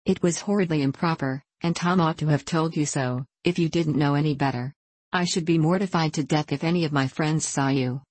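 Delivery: usual speaking voice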